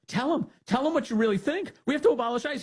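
high mocking voice of Jane egging him on; mock Jane urging. Jane urging him to speak, high mock voice. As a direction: pitch it high, a needling hammed-up woman. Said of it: "Jane's encouraging him".

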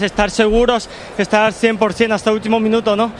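normal talking voice

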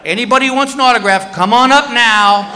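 mock public announcement; announcing to the line. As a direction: address a whole security line. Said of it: "he goes to this whole security line".

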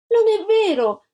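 put-on a child's loud, high cry of protest; shouting. A narrator's reading. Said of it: "gridò Antonella". (grido di bambina, più acuto e forte)

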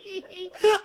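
burst of laughter. Real, not acted.

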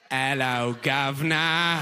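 slow, drawn-out mock Cockney; mock British accent. Fan apes a lost Briton's accent, mock British. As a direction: savour each sound in a silly British accent. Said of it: "You're like".

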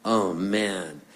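normal talking voice

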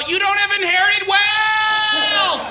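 drawn-out yell at the ex; mock yell from a water slide. Jilted DJ yelling at the ex mid-splash, slow shout. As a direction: holler it slowly down the slide. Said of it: "and just yell".

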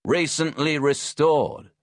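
slow, loud and clear. Acted. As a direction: spell it out loudly for a deaf man. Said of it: "I repeated loudly".

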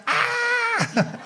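high thrilled mock shriek of excited kids; kids' shriek. Kids at the party shriek when Batman arrives, thrilled. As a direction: squeal it like a tiny kid seeing a hero. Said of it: "All the kids were like".

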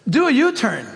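lightly imitated satnav command; a hint of a female GPS prompt; GPS girl's voice. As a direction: announce it like a navigation system, lightly voiced. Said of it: "it's in a girl's voice".

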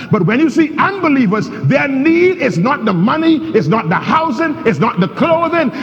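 a Sunday sermon in full cry; fiery preaching. Acted. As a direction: preach it out to the congregation.

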